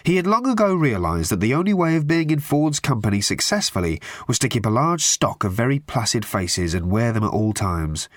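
ordinary talk

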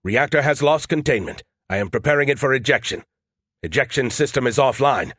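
ordinary delivery, nothing marked

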